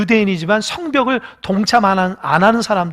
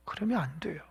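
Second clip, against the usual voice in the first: hushed, breathy warning; whispered warning; 3 semitones lower, 17 dB quieter than usual. Real, not acted. Preacher warning the congregation in a whisper. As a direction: whisper the warning close and intimate.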